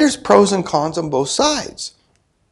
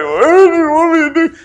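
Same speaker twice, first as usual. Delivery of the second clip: high, crying question to God; tearful plea; 13 semitones higher, 4 dB louder than usual. Retold or imitated. Preacher retelling crying in the bathtub, high and tearful. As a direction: beg through tears. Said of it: "And I'm crying".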